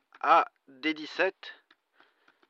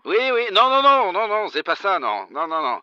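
exasperated; 6 semitones higher, 7 dB louder than usual. Acted. Caller exasperated at misunderstanding.

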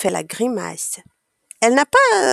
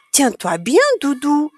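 calling out; 5 semitones higher than usual. A narrator's reading. Child on a bike warning Doudou, mildly calling out. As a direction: call a light warning to a passenger. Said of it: "crie Apolline".

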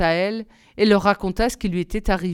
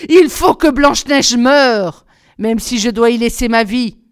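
the queen's enraged oath; furious cry; 3 semitones higher, 9 dB louder than usual. A narrator's reading. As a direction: swear it in a fury. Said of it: "la reine trembla de rage et de fureur et s'écria".

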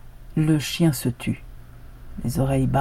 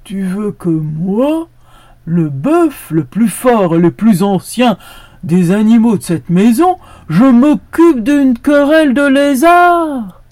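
swelling higher and louder in outrage; indignant ox; 7 semitones higher, 12 dB louder than usual. A narrator's reading. The old ox swells with outrage at the dog. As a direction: build from proud to outraged.

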